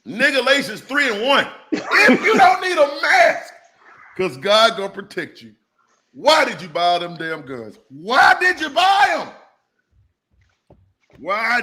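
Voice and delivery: hollering preacher, high and loud; preaching. Pretend. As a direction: preach it like Sunday morning. Mock-preaches the quoted scripture.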